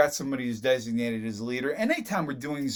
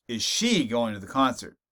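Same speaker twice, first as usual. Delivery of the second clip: she singled out, the rest lower; stress on she; 4 semitones lower than usual. Shown as a demonstration. Teacher showing how stress shifts meaning, weight on she. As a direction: stress she, as in her and not someone else. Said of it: "So in terms of emphasis".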